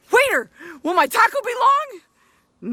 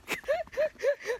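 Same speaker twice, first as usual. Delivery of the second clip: high laugh; 8 semitones higher, 11 dB quieter than usual. Real, not acted. Laughs at own taco joke.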